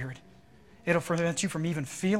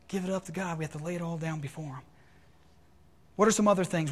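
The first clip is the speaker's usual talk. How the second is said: normal talking voice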